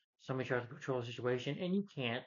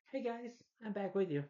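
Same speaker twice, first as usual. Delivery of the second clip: higher, bubbly imitation of Harley; a cheerful, higher mock Harley greeting; perky Harley voice; 8 semitones higher than usual. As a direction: chirp it like a ditzy villain.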